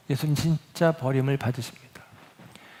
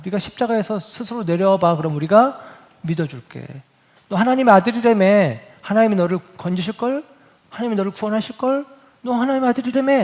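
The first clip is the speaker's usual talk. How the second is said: mocking taunt. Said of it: "예수님을 모욕하면서 이야기합니다". (점점 높아지는 조롱조 야유)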